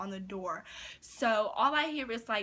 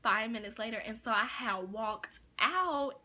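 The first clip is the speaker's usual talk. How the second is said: ordinary delivery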